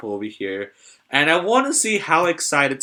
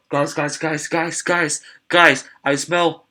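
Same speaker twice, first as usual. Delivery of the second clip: excited; 3 semitones higher, 3 dB louder, slower than usual. Real, not acted. Calling out to viewers, excited.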